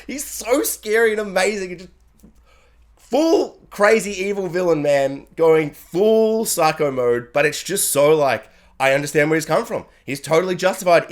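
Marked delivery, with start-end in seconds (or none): none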